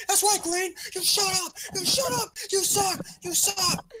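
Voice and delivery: high-pitched mock yelling; retold trash talk. Friend retelling a fight with Green, mock screaming. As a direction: imitate yourself yelling insults, way up high. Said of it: "I was like, I was like".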